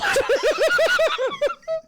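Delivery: delighted squealing laugh; high-pitched laugh. Real, not acted. Laughing at turning heel.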